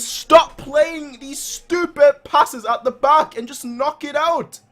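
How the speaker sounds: fed-up yell at the team; angry shouting. Real, not acted. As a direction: shout it, exasperated and demanding. Fan yelling at players on TV.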